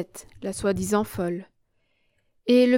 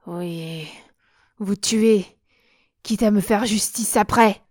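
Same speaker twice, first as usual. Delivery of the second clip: through gritted teeth. A narrator's reading. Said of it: "en grinçant des dents".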